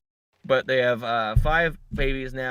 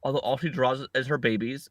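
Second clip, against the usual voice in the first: normal talking voice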